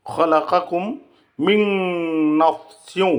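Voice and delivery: long nasal n from মিন into নাফসিউ; merged noon with ghunnah. Shown as a demonstration. Teacher repeating the required reading, merged with ghunnah. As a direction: hum through the join of মিন and নাফসিউ. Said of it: "ইদগামে বিল গুন্না করতে হবে".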